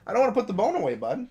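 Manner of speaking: high-pitched coaxing voice for a dog; sweet-talking the pup up high; pet talk. Real, not acted. Talking to the dog about bone.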